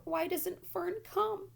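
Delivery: high, slow, pleading little piglet; piglet pleading for Fern. A narrator's reading. Frightened piglet begging for Fern to come. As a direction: stretch it out high and helpless.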